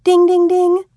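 loud high game-show bell; quiz bell ding. A narrator's reading. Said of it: "Jody onomatopoeied".

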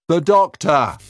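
high, loud call into the dark; shouting. Acted.

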